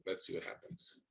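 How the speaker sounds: barely voiced, trailing off to himself; trailing whisper. Real, not acted. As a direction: let it fade into a whisper.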